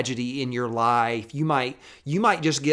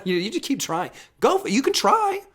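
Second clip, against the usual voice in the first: very high, louder mock cheer; mock encouragement; 11 semitones higher, 4 dB louder than usual. Preacher to those searching in vain, high mock encouragement. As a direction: egg them on in a squeaky-high voice.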